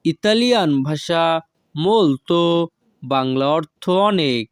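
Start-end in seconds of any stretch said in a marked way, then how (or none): none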